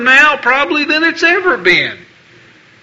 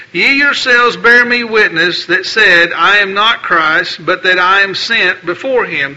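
formal, declaiming Bible reading; King James passage read from the pulpit; scripture reading; 3 semitones lower, 3 dB louder than usual. Acted. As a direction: proclaim the verses to the congregation. Reads scripture aloud.

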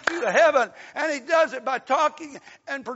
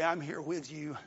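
soft comfort; 9 semitones lower, 11 dB quieter than usual. Acted. Voicing comfort to grieving mother.

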